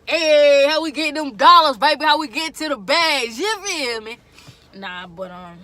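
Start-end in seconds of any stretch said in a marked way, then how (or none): 0.1-4.2 loud, high hype call to the crowd; hyped-up shout. Acted. Vlogger hyping viewers about trucker pay, loud shout. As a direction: pump them up about the money.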